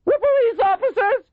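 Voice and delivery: loud, very high panicky yell; shrill, alarmed authority. A narrator's reading. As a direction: shriek it down as a warning. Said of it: "his voice suddenly shrill".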